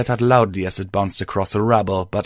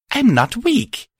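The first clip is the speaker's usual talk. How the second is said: high defiant; 4 dB louder than usual. Acted. Defiant reply.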